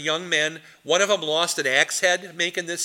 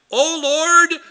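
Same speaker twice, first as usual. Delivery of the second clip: loud, high prayer cry; chaplain's shouted prayer; 12 semitones higher, 9 dB louder than usual. Retold or imitated. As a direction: cry out to the Lord like a field chaplain. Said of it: "he goes".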